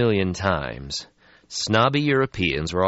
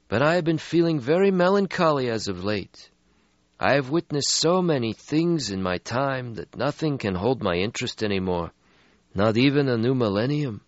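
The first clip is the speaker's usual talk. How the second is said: put-on weary old vampire; 8 semitones higher than usual. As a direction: sigh out an ancient regret. Stretsky voiced in story.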